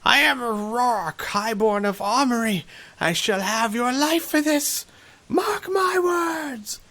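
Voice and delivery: slow, high threatening noble voice; angry young lord. Acted. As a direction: vow revenge, drawn out. Wounded lordling threatens the hero.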